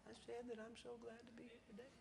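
put-on barely there, like a shy little girl; little girl answering the preacher, in a tiny voice; little girl's small voice. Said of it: "speaks in a small voice".

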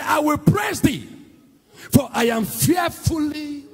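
high, shouted sermon climax; full-force preaching. Real, not acted. Telling the congregation to stop blaming themselves, full-force preaching.

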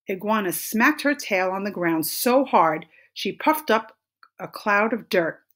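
usual speaking voice

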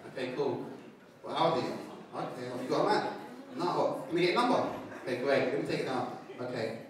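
low, soft, casual flirting voice; smooth chat-up. Acted. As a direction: play it cool and low while getting a number. Acting smooth chat-up for number.

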